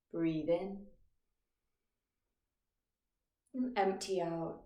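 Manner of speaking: calm, measured meditation guide with long pauses; guided meditation. Acted. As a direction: guide listeners gently into stillness, phrase by phrase. Leads a meditation.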